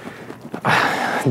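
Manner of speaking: long unvoiced sigh as the chest releases; deep sigh. Real, not acted. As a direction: let out a slow, breathy sigh. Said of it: "I have an involuntary, uh, respiration".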